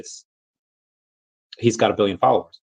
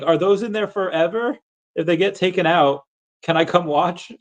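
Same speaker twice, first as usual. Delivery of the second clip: higher, curious reading of the chat message; reading a cute question; 7 semitones higher than usual. Retold or imitated. Reading out a chatter's curious questions in a lightly cute voice. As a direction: read it like an eager kid asking. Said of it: "She's like".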